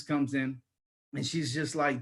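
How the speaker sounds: ordinary talk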